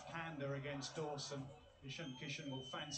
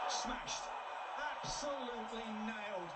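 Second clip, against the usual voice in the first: excited shout; 7 semitones higher, 4 dB louder than usual. Real, not acted. Big hit, commentator erupts.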